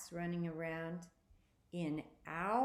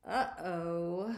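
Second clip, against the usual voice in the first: loud alarmed uh-oh; near-scream of panic; almost screaming; 5 dB louder than usual. A narrator's reading. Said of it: "almost screaming".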